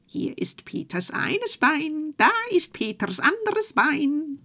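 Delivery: a mischievous sprite calling down to the farmer; teasing goblin call. Acted. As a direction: taunt from up in the gable, high and slow.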